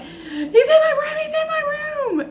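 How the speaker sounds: high crying plea to neighbours for help; crying in panic. Retold or imitated. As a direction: sob it out high and frantic. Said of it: "And I'm like".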